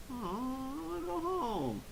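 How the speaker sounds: high, slow, soft mock whine; Goku. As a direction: drag it out like a sulking child. Voices Goku complaining about shopping.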